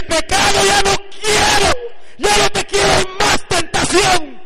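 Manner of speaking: very loud, high-pitched preacher's shout; full-force preaching. Real, not acted. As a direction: belt it out to the congregation, stretching the words.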